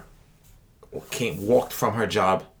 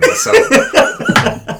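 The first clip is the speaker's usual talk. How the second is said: laughing; 12 dB louder, much slower than usual. Real, not acted. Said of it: "laughs while saying it".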